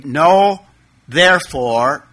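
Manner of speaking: "no" punched loud, then "therefore" rising; literal word order. Shown as a demonstration. Preacher showing the literal Greek order, emphatic. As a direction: spell out "no, therefore" slowly, word by word. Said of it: "translated it just strictly literal".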